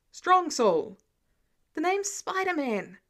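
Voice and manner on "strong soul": louder, booming repeat; loud mock Thor. A narrator's reading. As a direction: throw it out like the god did. Voicing Thor's boast.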